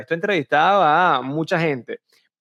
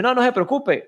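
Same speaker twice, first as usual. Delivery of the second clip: put-on girl brushing it off; 10 semitones higher than usual. Girl answering teacher about accent.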